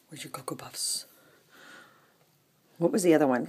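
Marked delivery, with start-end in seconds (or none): 0.1-1.0 whispered, much softer, teasing. Acted. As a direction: breathe the question to the cat. Whispered question to the cat.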